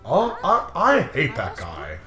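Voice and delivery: usual speaking voice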